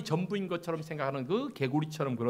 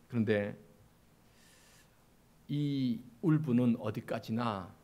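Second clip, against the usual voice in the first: usual speaking voice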